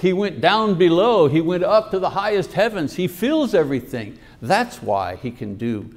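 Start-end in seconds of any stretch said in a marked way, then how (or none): none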